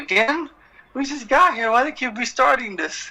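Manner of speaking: high, laughing mock exasperation; exasperated kid. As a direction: whine it like a fed-up young fan, half laughing. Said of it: "I was like".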